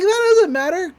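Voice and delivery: high brush-off. Acted. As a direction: squeak it out to dodge the question. Mabel brushes off question.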